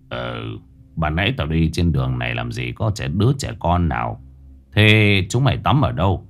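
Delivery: put-on shaken old man. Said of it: "ông tái mặt".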